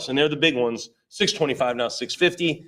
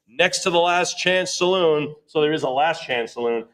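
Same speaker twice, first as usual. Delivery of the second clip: ordinary talk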